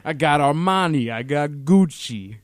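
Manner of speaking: loud, slow Italian mobster bragging; Italian mobster accent. Retold or imitated. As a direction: brag about designer labels like a mob boss. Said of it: "That's the worst Italian accent ever".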